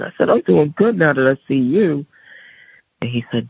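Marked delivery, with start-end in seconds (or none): 0.3-2.0 higher-pitched, glad answer; delighted reply. Retold or imitated. Grandson greeting great-grandfather he is glad to see, delighted. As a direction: answer with lifted, happy pitch.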